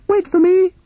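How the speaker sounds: high piping shout from behind; calling out. A narrator's reading. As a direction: call after them in a tiny high voice. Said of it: "called Toad".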